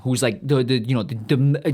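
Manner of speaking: ordinary talk, nothing marked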